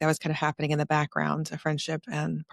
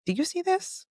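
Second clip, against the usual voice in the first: high questioning quote; 14 semitones higher than usual. Retold or imitated. Retold explaining to others.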